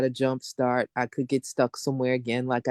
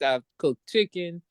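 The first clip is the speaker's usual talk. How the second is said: ordinary delivery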